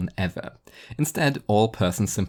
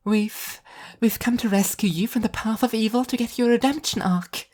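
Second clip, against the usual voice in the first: high, bright gleeful angel voice; gleeful exclaiming; 14 semitones higher than usual. Acted. Angel gleefully tells the villain she'll be redeemed, high and bright. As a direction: announce the rescue with cheerful glee. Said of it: "exclaimed gleefully".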